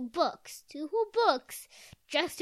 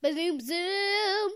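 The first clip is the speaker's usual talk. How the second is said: higher, louder bazoom, bazoom; 8 semitones higher, 8 dB louder, slower than usual. Shown as a demonstration. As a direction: really belt out bazoom. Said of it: "get these levels one more time".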